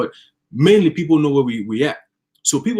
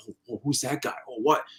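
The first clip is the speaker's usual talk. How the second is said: much softer, awed murmur; hushed awe; 3 semitones lower, 10 dB quieter than usual. Retold or imitated. Train riders spotting the guy, hushed awe. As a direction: gasp under your breath.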